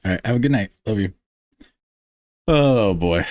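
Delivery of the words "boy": ordinary delivery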